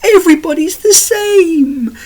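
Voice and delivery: extremely high, loud silly parody; mock the slogan in a squeaky put-on voice, into babble; mocking liberal voice. Ranter ridiculing liberalism, very high mocking voice. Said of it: "this is what you get with liberalism".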